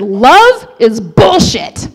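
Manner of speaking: loud, slow, punched-out bitter verdict; fed-up, heartbroken proclamation at full voice; emphatic declaration. Retold or imitated. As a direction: declare it hard, word by word. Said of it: "I immediately declared".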